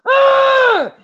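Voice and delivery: high loud imitated panic scream "Ah!"; panicked scream. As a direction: shriek it out like a man in total panic. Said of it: "he turns Billy around and he's like".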